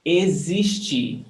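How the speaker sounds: Existir said slowly with a clear Z for the X; slow Existir, "eh-zeesh-CHEE". Shown as a demonstration. Teacher modeling pronunciation for the class, X as a slow Z. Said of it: "The X is pronounced as Z".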